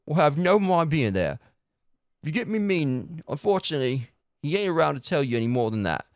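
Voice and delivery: lightly Cockney game character; Cockney accent. Acted. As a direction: rattle it off like a Cockney busybody. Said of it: "That was a pain in the ass to read".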